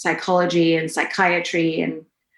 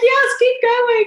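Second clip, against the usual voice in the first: much higher, slow eager cheer; imitating herself urging the preacher on, much higher; excited cheer; 5 dB louder, slower than usual. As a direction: cheer him on, high and drawn out. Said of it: "I'm like".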